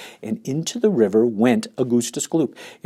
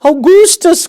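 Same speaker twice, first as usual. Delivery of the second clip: very high, loud call of the name; a mother calling her son away from the river; 12 dB louder than usual. Acted. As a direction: yell across to him. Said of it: "called out Missus Gloop".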